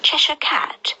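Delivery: put-on high girlish call; Alice voice. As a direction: call up to the cat, young girl. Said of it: "she said".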